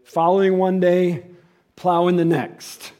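loud, slow, hammering preaching. Acted. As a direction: drive it home loud and slow. Preacher's rising exhortation.

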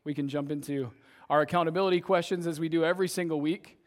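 usual speaking voice

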